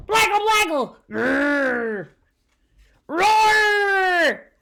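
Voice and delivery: high loud hammed-up monster growls; monster roar. Monster trying to scare Sam, loud roar. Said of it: "the monster gave it all he had".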